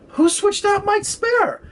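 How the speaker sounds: high, slow, drawn-out disbelief; stunned outburst. Retold or imitated. Telling the tow driver, slow high disbelief. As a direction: stretch it out high like you can't believe it.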